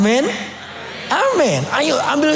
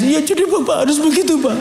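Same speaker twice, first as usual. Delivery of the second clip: crying while advising; 7 semitones higher than usual. Retold or imitated. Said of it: "saya nasihati sambil nangis".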